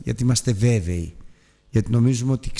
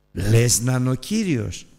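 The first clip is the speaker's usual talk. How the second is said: slow refrain. Shown as a demonstration. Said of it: "refrain repeated slowly".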